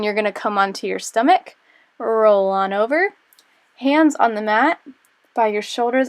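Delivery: usual speaking voice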